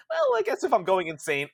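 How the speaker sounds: high, loud, fast mock madness; mock frenzy. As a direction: play at losing your mind. Mock dramatic going insane.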